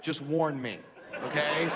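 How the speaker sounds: ordinary delivery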